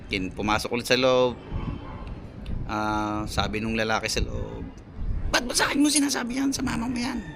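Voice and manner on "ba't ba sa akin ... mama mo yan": mimicking yelling man. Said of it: "Pasigaw, sinisigawan yung bata".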